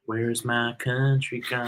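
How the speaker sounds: slow, drawn-out quote imitation; a hint of cartoon character, very slow; lightly voiced cartoon quote. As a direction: stretch the words out like a cartoon line. Quoting a South Park character.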